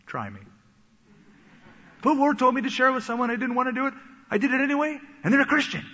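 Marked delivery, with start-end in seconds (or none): 2.0-5.9 high, loud, breathless telling; excited testimony. Retold or imitated. A church member tells the pastor their story, high and excited. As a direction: burst out with the good news.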